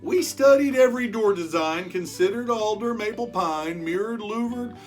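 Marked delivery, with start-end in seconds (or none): none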